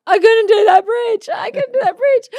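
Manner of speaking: put-on high, loud, childlike wail; like a child. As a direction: cry it out to dad like a little kid. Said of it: "like a child".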